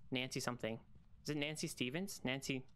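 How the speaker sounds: ordinary talk, nothing marked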